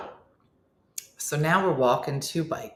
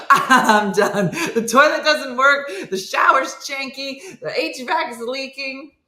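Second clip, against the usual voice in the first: high-pitched ranting list of complaints; escalating mock meltdown, fed up; comic breakdown; 8 semitones higher, 5 dB louder than usual. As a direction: rant with laughter breaking in. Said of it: "that's the beginning of the breakdown".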